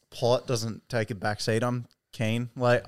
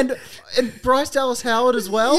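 mock incredulous; 11 semitones higher, 6 dB louder than usual. Real, not acted. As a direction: stretch it out in fake shock. Continued mock disbelief.

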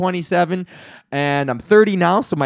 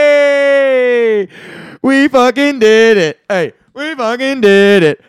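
triumphant cheer; 6 dB louder than usual. Real, not acted. Comic celebrating making the finals, loud triumphant chant. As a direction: chant it like a victory lap. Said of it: "celebrating finalist news".

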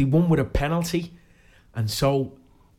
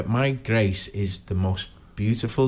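ordinary talk

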